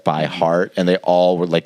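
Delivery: ordinary talk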